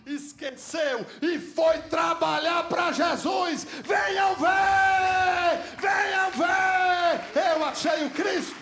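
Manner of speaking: loud, high calling out; crying out the woman's joyful news; shouted preaching. Acted. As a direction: call the town to come and see.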